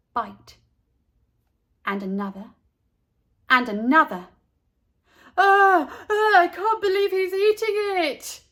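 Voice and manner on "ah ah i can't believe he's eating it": high, loud grossed-out cry; disgusted. A narrator's reading. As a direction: recoil at something gross. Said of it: "said Natey in disgust".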